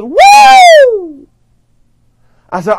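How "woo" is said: high, loud whooping cheer; crowd's woo. Retold or imitated. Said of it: "all I heard them say was".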